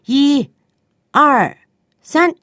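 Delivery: loud, rising slow count; mock angry parent counting one two three. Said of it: "有的做父母的呢".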